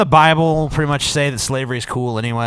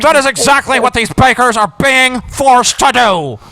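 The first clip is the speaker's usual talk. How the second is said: higher, louder nasal mimicry; nasally angry mimic; 9 semitones higher, 5 dB louder than usual. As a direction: parrot him in a whiny nasal voice. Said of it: "Listen to the anger in my nasally voice".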